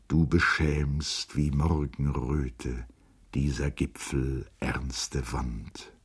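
slow, hushed recitation, as if awed. A narrator's reading. August recites his father's verses to Charlotte, timid and hushed. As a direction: recite it low and softly, drawing out the verse. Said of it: "mit zaghafter und wie erschrocken gesenkter Stimme".

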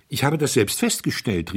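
pushing in to be heard; called out. Acted. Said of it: "rief der junge Herzog".

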